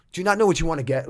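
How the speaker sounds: impatient, snapped at a customer; snappy. Retold or imitated. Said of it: "was kinda snapping at me".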